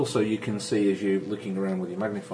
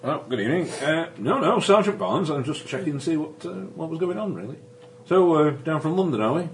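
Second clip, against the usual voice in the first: higher, louder Yorkshire country policeman; a nosy Yorkshire bobby, chummy and higher; Yorkshire constable; 6 semitones higher, 4 dB louder than usual. Acted. GM playing the Yorkshire constable to the travellers, higher and louder. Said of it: "I speak with the Yorkshire accent".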